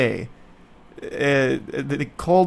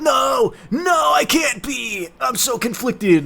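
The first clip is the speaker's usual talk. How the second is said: higher, drawn-out mock wail of denial; hammed-up anguish; 9 semitones higher, slower than usual. Halfling learns she is half human, hammed-up wail. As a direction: overplay a soap-opera denial. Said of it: "And she's like".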